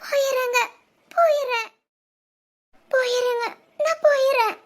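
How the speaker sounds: tearful goodbye; crying. Acted. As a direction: sob it out, broken. Crying as she's pushed out.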